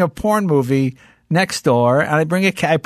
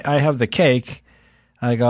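ordinary delivery

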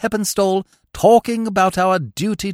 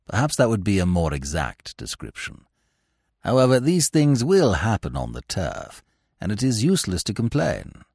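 lower, softer, measured valet; unflappable valet; 8 semitones lower, 4 dB quieter than usual. Acted. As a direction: keep it calm and deferential. Jeeves answers Bertie.

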